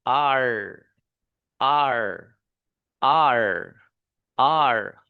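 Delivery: "आर" repeated slowly with a smooth untrilled r; English R. Shown as a demonstration. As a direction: model each "आर" separately, drawn out. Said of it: "ये वाला". (बिना लुढ़काए साफ़ "आर")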